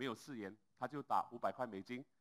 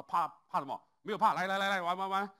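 louder, higher bluster; indignant eager; 7 semitones higher, 11 dB louder than usual. Retold or imitated. Lawyer insisting to Lao Lin, loud and eager. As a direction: brush off the insult and push to play.

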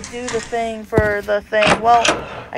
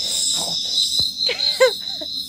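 breathy laugh; 10 semitones higher than usual. Real, not acted. Laughing after scratch noises.

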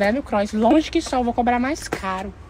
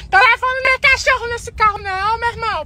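angry shout; 11 semitones higher than usual. Acted. Yelling at the driver.

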